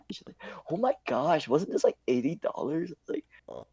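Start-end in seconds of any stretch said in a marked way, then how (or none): 0.7-2.9 higher, softer mock awe; gushing classmate. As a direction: imitate a classmate's hushed amazement. Retelling classmate gushing over wallet.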